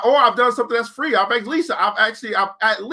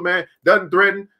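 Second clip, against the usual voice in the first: ordinary talk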